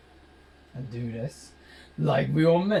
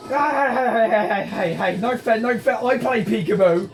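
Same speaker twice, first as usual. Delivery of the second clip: high, loud yelps of protest; excited protest; 7 semitones higher, 5 dB louder than usual. Real, not acted. Protesting the unfair game, loud and high.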